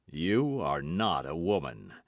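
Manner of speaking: low, slow, cold verdict; cold and stern. A narrator's reading. Athos coldly condemning Milady. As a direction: pronounce it coldly, like a sentence. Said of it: "coldly and sternly".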